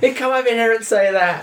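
high-pitched, goading goblin challenge; high goblin taunt. Acted. Goblin daring the other to come over, high and goading. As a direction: squeak it out like a cocky goblin. Player voices arguing goblin.

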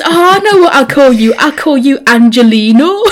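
high, loud, delighted mock Geordie driver; gleefully name the little angel; bus driver voice. Bus driver naming the angel he found, high and delighted. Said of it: "And he goes".

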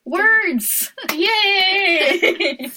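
high, playful cheer with a laugh; squealed, laughing hurrah; gleeful cheer. Pretend. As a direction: whoop it out in mock celebration. Mock celebration of an imagined instrument.

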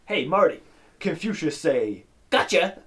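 slow setup, then loud punchline; acted-out gotcha moment; triumphant reveal. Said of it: "he goes".

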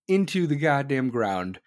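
ordinary talk, nothing marked

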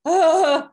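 high, loud mock groan of embarrassment; embarrassed groan. Guest who hates talking about herself, cringing groan. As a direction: let out an exaggerated cringing "ugh".

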